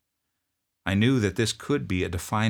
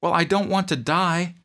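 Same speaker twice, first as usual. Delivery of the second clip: put-on much higher, strained young voice; pleading private; 12 semitones higher, 7 dB louder than usual. Homesick private begging his drill sergeant, high plea. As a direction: plead like a scared recruit.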